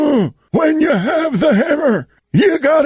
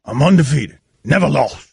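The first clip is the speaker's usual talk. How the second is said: much lower, boastful voice; LeVar Ball impression; 13 semitones lower, faster than usual. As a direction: brag low like a proud sports dad. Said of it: "fake LeVar Ball".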